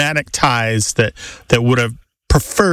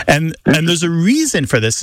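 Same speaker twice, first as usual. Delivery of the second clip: normal talking voice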